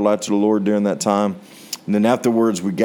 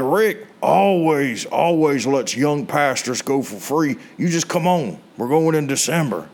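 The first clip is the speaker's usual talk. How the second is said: put-on friend Joey's twangy country accent, pitched up; 3 semitones higher than usual. Preacher imitating his friend Joey in a country accent. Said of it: "a very country accent".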